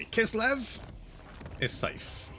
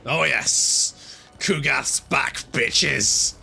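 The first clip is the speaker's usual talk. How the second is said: loud triumphant cheer; excited shout; 2 semitones higher, 7 dB louder, much slower than usual. Real, not acted. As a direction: gloat loudly over the comeback. Cheering his returning unit.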